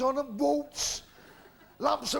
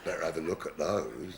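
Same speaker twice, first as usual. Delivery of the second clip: much lower, leering vicar voice; lecherous vicar; 5 dB quieter than usual. Acted. Comedian voicing the vicar eyeing the girl, low and leering. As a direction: drop deep and sly like a dirty old vicar.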